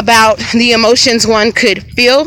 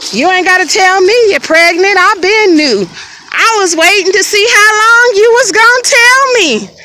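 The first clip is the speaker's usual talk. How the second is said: high, emphatic imitation of a grandmother; mock told-you-so outburst; knowing grandmother scolding; 8 semitones higher than usual. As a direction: voice a grandma who saw it coming, pitch climbing. Grandmother voiced confronting pregnant teen.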